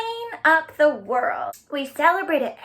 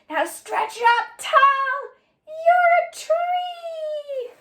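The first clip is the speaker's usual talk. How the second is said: high, slow, rising shout of the last line; a big cheering finish to the rhyme; excited climax; 14 semitones higher, much slower than usual. Acted. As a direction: stretch the voice up with the kids. Poem climax, kids stretch up.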